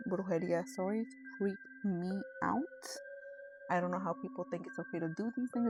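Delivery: ordinary talk, nothing marked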